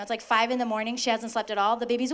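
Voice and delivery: usual speaking voice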